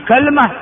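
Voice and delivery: كلمة as kalma; lightened middle. Shown as a demonstration. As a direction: say كلمة with a and vowelless l. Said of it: "ثلاث لغات". (كلمة بفتح الكاف وتسكين اللام)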